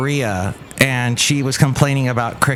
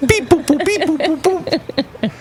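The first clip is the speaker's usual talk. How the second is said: phone beeps. Pretend. Imitating a phone dialing.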